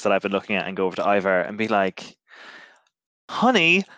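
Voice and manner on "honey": high, loud put-on accent; sweet wifely question in Ivar's accent; wife asks husband about a recipe in a put-on accent. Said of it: "put on this, like, a voice that's more similar to Ivar's".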